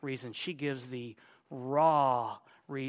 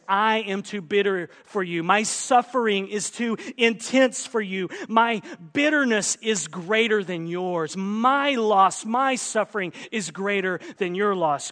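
louder, forceful lament; insistent bitterness; 5 semitones higher, 8 dB louder than usual. Acted. Naomi telling the girls her suffering is greater, bitterly insistent. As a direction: press the grievance home with force.

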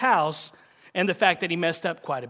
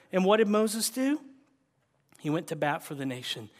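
normal talking voice

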